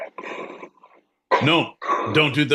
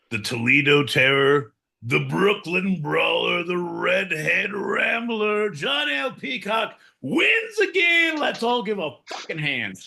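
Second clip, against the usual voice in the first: mock boxing announcer; 7 semitones higher than usual. Mock ring announcer introduces John.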